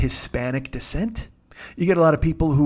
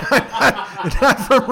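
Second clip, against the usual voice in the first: laughing; 6 semitones higher, much slower than usual. Real, not acted. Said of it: "laughing at own slip".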